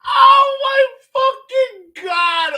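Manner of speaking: loud, slow, high-pitched wail of laughter; crying with laughter. Real, not acted. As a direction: howl it out, helpless with laughter. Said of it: "I'm crying right now".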